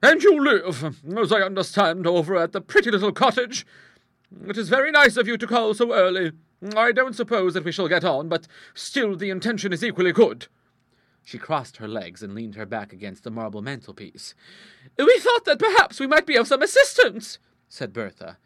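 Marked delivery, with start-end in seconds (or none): 0.0-10.5 high, loud, assured character voice; big-voiced matter-of-fact lady; strong-willed hostess. 15.0-17.4 very high-pitched nervous offer; anxious little lady, pitch raised right up; timid. A narrator's reading.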